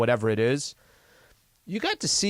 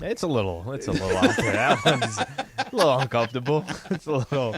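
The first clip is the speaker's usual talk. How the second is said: laughing. Real, not acted. Laughing awkwardly.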